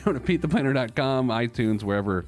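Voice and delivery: normal talking voice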